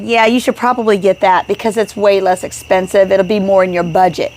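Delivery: ordinary delivery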